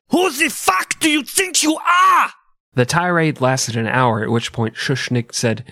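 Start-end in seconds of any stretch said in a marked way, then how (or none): 0.1-2.3 very high, drawn-out screamed outburst; hammed-up, high-pitched tyrant yell; furious mock shout. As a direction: shriek it slowly like an enraged dictator. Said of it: "and then shouted".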